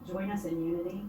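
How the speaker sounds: soft, earnest praying voice; prayer. Acted. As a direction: pray quietly and sincerely. Leads opening prayer at meeting.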